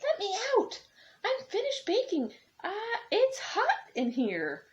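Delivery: high, loud little cookie voice crying out; the gingerbread man calling from the oven, high and loud. Acted. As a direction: squeak out from inside the oven.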